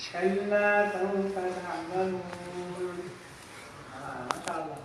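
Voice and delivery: soft, mellow melodic recitation of "كلا سوف تعلمون"; mock soothing recital; sweet recitation. Shown as a demonstration. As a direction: chant it gently as if nothing is wrong. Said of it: "it's the opposite of the intent".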